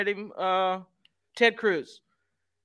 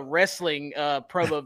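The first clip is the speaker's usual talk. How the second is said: normal talking voice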